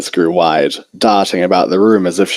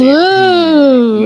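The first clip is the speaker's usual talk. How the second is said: high, louder admiring ooh; 5 dB louder than usual. A narrator's reading. Rarity impressed by Celestia's prize, an admiring high ooh. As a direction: coo in admiration. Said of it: "Rarity oohed in admiration".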